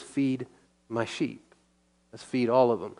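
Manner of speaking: ordinary talk, nothing marked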